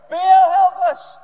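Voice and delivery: very loud, high acted shout. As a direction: bellow a drawn-out plea. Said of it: "And they got louder".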